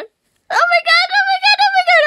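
very high, loud repeated squeals; high squealing. Real, not acted. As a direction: shriek it over and over. Fan freaking out.

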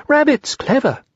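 high-pitched small creature voice; high little storybook piglet. A narrator's reading. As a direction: echo it in a high piping voice. Narrator voices Piglet agreeing.

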